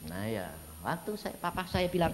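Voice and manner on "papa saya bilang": usual speaking voice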